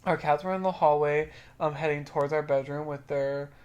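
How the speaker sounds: quiet, even reading voice; reading a stranger's story aloud; reading a comment aloud. A narrator's reading. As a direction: read the post out softly. Reads a Reddit comment.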